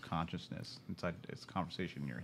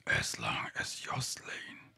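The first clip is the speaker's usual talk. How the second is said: eerie ancient whisper of a dark power; gravelly whisper. Acted. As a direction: hush it out like a creeping voice from the shadows. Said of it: "things got a little quiet and a little gravelly".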